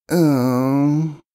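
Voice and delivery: high, unsure little um; hesitant filly. Acted. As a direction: balk at the idea. Scootaloo hesitates.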